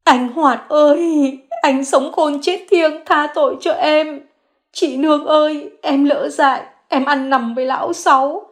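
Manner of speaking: high, slow wailing, crying out; wailing sobs. Acted. Xuyến wails before Hoạt's portrait, begging forgiveness. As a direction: wail as if mourning a parent. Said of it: "khóc như cha mẹ mình qua đời".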